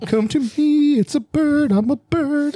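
high coaxing fake-bird monster voice; mock monster bird voice. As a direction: play a creature faking a bird, coaxing and high. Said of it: "It sits there and it's like".

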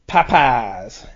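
Popeyes drawn out slowly as "PAH-pahz"; local Southern pronunciation. Shown as a demonstration. Showing the host how locals say Popeyes, local pronunciation. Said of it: "how people say things, and we say".

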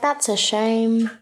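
high, drawn-out pity; mock sympathy. Retelling the family hearing of a sick dog, mock sympathetic.

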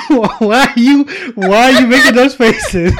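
high, loud laughing talk; words squeezed out through laughter. Real, not acted. Laughing after bad joke.

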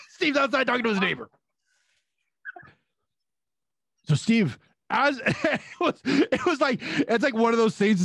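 high laughing retelling. Real, not acted. Recalling being spotted by Steve, laughing. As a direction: tell it through laughter.

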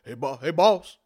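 lightly voiced nervous henchman; worried orc calling his boss; Boris orc voice. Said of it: "and he goes".

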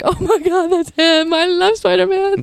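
high-pitched gushing fan; excited fangirl. Retold or imitated. Student spotting Andrew Garfield in Paris, starstruck gushing.

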